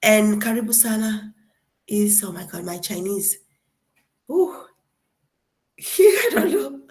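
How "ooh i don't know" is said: high, laughing embarrassment; high embarrassed laugh. Real, not acted. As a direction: giggle through the not knowing. Forgetting Chinese, laughing.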